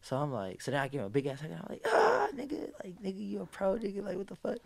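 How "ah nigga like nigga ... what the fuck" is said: breathy, high, hushed hype greeting; excited whisper-shout. Retold or imitated. Hugging his friend who just turned pro, hushed excited gushing. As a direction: hug him and gush.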